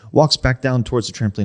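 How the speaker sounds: ordinary talk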